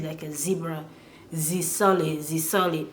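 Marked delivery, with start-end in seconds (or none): 1.3-2.9 "Z solid" with z instead of "the". Shown as a demonstration. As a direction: say "Z solid" with a French z. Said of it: "you hear it as Z".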